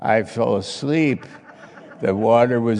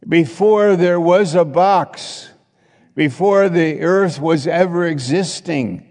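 rising proclamation; 7 semitones higher, 5 dB louder than usual. Acted. Preacher builds to a climax.

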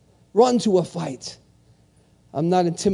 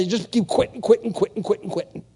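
trailing repetition; 2 semitones higher, faster than usual. Real, not acted. Preacher describing serial quitters, fading repetition. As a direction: let the repeats run on and die down.

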